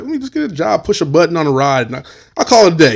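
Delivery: put-on high, pleading young job seeker; eager kid. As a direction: imitate a hopeful kid pitching himself. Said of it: "you got this kid fresh out".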